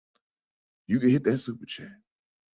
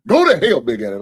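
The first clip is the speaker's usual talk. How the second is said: mock yell; 8 dB louder than usual. Mock insult to a donor.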